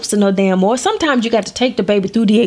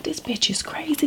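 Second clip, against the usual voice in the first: whisper; 4 semitones higher, 10 dB quieter than usual. Retold or imitated. Kid's thoughts whispered.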